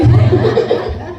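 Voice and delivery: high, happy burst of laughter; delighted laugh. Real, not acted. Devotee laughing with joy as the guru finally greets her. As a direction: laugh out in joyful disbelief.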